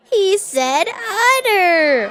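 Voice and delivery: laughing. Acted. Laughing at joke.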